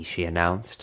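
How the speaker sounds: ordinary delivery, nothing marked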